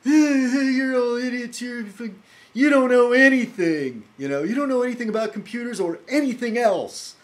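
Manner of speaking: loud, high mock tirade; hammed-up high-pitched furious shouting; mock angry rant. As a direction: jump down their throat, high and yelling. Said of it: "this guy just jumped down my throat".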